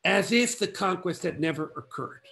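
ordinary delivery

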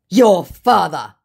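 slow, loud, high put-on voice; sling it as a drawn-out scammer insult; hammed-up retort.

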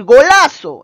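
golazo shouted high and loud like a goal cheer; excited exclamation. Shown as a demonstration. Teacher showing an exclamation, cheering a goal. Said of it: "en signo de admiración".

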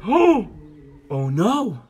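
loud, high startled "oh", then falling; acted sudden dismay; dismayed shock. A narrator's reading. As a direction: cry out as bad news lands. Learns all the money is gone.

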